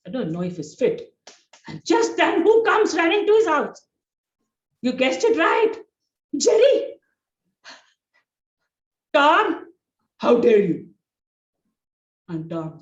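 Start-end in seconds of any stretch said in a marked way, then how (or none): 1.7-6.9 high, loud storyteller build-up to the big entrance; excited build-up. A narrator's reading. 9.1-10.9 loud accusing outburst; angry Jerry. Acted. Jerry bursts in angry at Tom.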